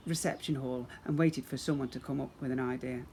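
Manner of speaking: usual speaking voice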